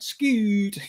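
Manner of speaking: Scoot! in a mock Irish accent. Teasing co-host Scott by name, in mock Irish accent. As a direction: call Scoot! with a light Irish lilt. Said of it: "With the Irish accent".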